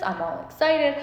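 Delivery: ordinary talk, nothing marked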